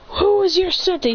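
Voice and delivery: high-pitched reply; acted bewilderment; alarmed question. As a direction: ask urgently under attack. Attacked ninjas ask back.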